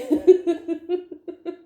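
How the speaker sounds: loud, high burst of laughter; bright joyful laugh bursting out. Real, not acted. Recalling her joy.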